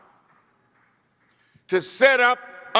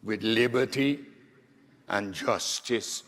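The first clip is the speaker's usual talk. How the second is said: son lightly voicing his mother's West Indian accent, lower and softer; a gentle, low hint of a West Indian mother's lilt; imitating mother's West Indian accent; 8 semitones lower, 5 dB quieter than usual. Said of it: "with her beautiful West Indian accent".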